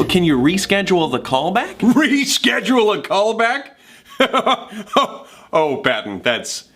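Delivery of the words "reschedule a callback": high, incredulous laughing repeat; laughing scoff. Acted. Actor answers friend's idea to reschedule, laughing in disbelief.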